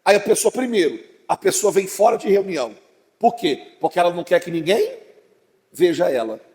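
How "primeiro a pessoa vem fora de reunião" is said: usual speaking voice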